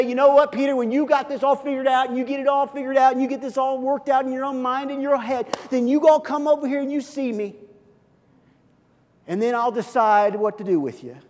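brisk, dismissive lecturing voice; hammed-up indifferent master; mock aloof Jesus. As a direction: sound conditional and cold. Imagined distant Jesus, mocking.

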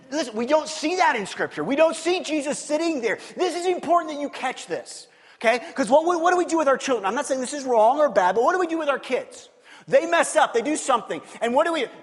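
high, loud, driving preacher voice; preacher pressing the congregation hard about children; full-force preaching. Acted. Preaching loud and high to the church about scolding kids.

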